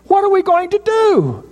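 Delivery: servant's fear boils over, voice cracking high; desperate high-pitched repeat; shrill panicked cry. Retold or imitated. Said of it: "he cried out".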